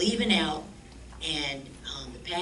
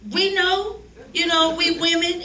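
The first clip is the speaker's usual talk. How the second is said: much higher, loud indignant protest; exasperated; 13 semitones higher, 10 dB louder, slower than usual. Retold or imitated. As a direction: insist loudly you know you're women. Annoyed at old lady.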